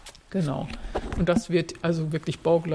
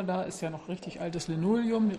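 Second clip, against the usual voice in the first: normal talking voice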